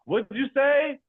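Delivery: very high mock baffled question; confused customer. Customer who cannot follow the sales call, high-pitched. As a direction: ask high, as if you missed the words.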